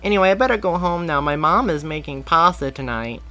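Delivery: put-on high, bright kid voice; young boy. A narrator's reading. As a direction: play an eager little boy. Boy says goodbye.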